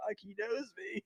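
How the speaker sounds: dismayed aside. Retold or imitated. Realizes sergeant major recognizes him.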